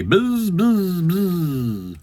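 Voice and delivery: three high buzzes, sliding down; fly buzz. Acted. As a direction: buzz like flies, one after another. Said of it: "The flies say".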